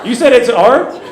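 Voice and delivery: high, loud bewildered yelling; baffled shout. Real, not acted. Asking the crowd about his girlfriend's hair spiral, shouting in disbelief. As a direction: throw the question out in disbelief.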